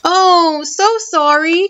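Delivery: high, mildly flustered shopkeeper; apologetic cry. A narrator's reading. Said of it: "cried the shopkeeper".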